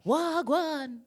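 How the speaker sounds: high, loud, sing-song Jamaican greeting; mock Jamaican accent. Mimicking a friend's high accent, loud Jamaican. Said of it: "Try and bring it down".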